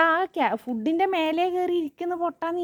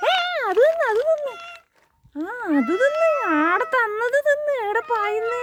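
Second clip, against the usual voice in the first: much higher, loud excited urging; 10 semitones higher, 4 dB louder than usual. Real, not acted. Urging the pet to eat what was given, high excited. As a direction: push the pet to eat, eager.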